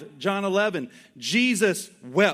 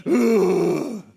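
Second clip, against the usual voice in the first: a louder put-on fed-up groan; exasperated dad groan; 4 dB louder than usual. Dad fed up with his kid, mock groan. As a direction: groan like a dad who has asked too many times. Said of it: "and the daddy's like".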